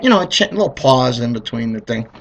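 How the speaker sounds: ordinary delivery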